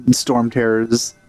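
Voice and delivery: ordinary talk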